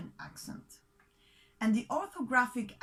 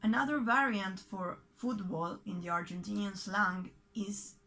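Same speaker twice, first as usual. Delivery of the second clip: ordinary delivery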